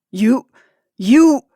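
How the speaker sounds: husband choking on fury at his wife. Acted.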